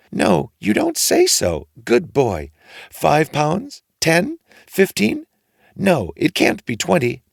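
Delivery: mother hoping for a fortune; excited mother. A narrator's reading. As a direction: guess bigger and bigger, thrilled. Mother guesses eagerly.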